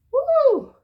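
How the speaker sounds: high, loud celebratory woo; a real whoop of joy; cheering whoop. Real, not acted. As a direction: cheer the card like a win. Cheering a good card.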